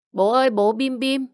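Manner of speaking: put-on high child's voice calling for dad. Little son runs in calling his father for snacks, high child voice. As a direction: call out like a little kid wanting snacks.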